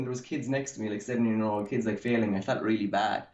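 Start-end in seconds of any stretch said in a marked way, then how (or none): none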